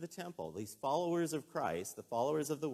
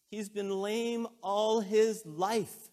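loud, high, slow emphatic cry; impassioned preaching; 9 semitones higher, 9 dB louder, much slower than usual. Real, not acted. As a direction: proclaim it slowly at full force. Preacher pleads for the healed man.

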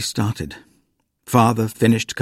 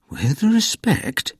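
slight tremor; 9 semitones higher, much slower than usual. A narrator's reading. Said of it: "a slight tremor in his voice".

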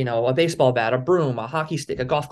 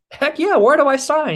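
higher and louder, thrilled to accept; eager yes; 12 semitones higher, 5 dB louder than usual. Retold or imitated. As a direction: grab the deal with mock glee. Jumping at a big offer.

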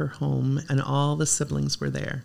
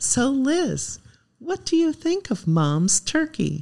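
much higher, sing-song mock-innocent question; teasing brother-in-law; 10 semitones higher, slower than usual. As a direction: bait her with fake sweetness. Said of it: "my loving brother-in-law said".

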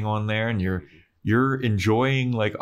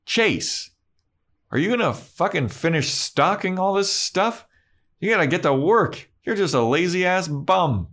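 angry boss; 10 semitones higher than usual. Retold or imitated. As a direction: berate a lazy employee from the back. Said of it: "a lady comes from the back and she goes".

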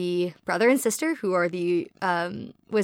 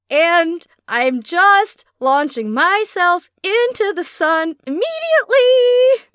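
high, loud and slow, over the top; dramatic anguished wail; overwrought wail; 10 semitones higher, 7 dB louder, much slower than usual. Real, not acted. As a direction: wail it like you're flinging yourself into the sun. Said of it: "describing her own reaction".

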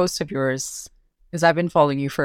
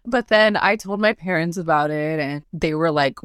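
ordinary talk, nothing marked

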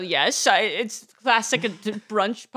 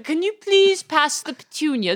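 higher-pitched lordly British accent; British accent demo; 6 semitones higher, 3 dB louder than usual. Shown as a demonstration. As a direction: demonstrate the British accent as a lord. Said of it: "good hook phrase to get into the accent".

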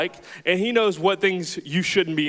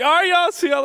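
much higher, louder farewell; cocky goodbye; 12 semitones higher, 5 dB louder than usual. Retold or imitated. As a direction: bail out of the car boldly. Leaving the car, hyped.

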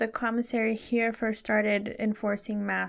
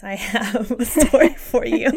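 louder slow teasing announcement; loud playful announcement; 10 dB louder than usual. Real, not acted.

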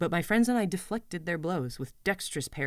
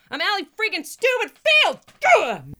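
much higher, louder yell; furious shout; 9 dB louder than usual. Acted. Girl yelling her own name in fury. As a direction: scream it at the rafters. Angry outburst climax.

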